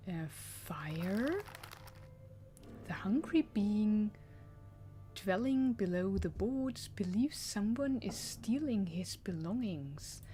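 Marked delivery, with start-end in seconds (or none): none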